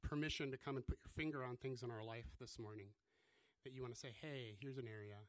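soft, hushed prayer voice. Acted. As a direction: pray quietly and reverently. Opening prayer.

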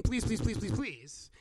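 rapid repeated pleading, dropping off; begging. Shown as a demonstration. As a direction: plead over and over in a string. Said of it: "It wasn't begging".

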